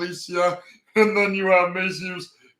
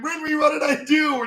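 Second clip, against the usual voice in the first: much higher panicked protest; mock startled yelp; 9 semitones higher than usual. Alphonse being hit by Winry's wrench, high panicked protest. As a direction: cry out high, caught off guard.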